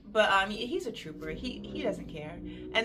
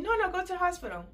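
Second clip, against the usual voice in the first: quick, high mock voice of the husband; imitating husband; 10 semitones higher, much faster than usual. Husband telling wife to go to hospital, quick high mock voice. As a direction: rattle it off fast like a flustered husband.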